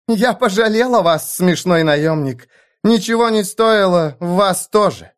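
high-pitched taunting voice; mocking defiance. A narrator's reading. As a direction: taunt him to his face. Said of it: "Она засмеялась ему в лицо".

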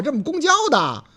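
usual speaking voice

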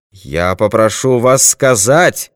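man's forceful, stretched-out order; shouted demand. A narrator's reading. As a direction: demand it loudly, word by word. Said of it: "крикнул он".

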